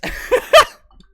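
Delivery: real laugh bursting out, high; cracking up at the comment; loud burst of laughter. Real, not acted. Everton fan laughs hard reading a rude comment, loud burst of laughter.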